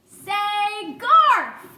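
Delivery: high, loud mouse shout; top of mouse voice. A narrator's reading. Desperate mouse to a boy who didn't hear, shouting. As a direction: call out as loud as a mouse can. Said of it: "at the top of his mouse voice".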